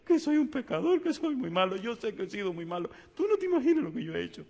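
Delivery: high, tearful voice; imitating a tearful confession. The crying man confesses to the pastor, high and tearful. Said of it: "Y sigue llorando".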